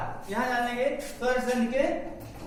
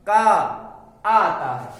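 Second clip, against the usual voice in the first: very slow, stretched का with a louder level; slow sounding out; 6 dB louder, much slower than usual. Shown as a demonstration. Teacher stretching the sound for students, slowly. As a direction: draw out का so the class hears the आ at its end. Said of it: "अंत में इसका उच्चारण आता है".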